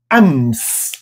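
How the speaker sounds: "ams" with a real lip-closed M; Arabic closed M. Shown as a demonstration. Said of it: "Fecha os lábios e pronuncia o M".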